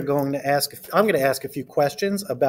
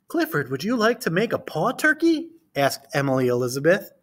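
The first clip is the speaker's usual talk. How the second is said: put-on reading to children. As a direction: read it to a class of small kids. Reads picture book aloud.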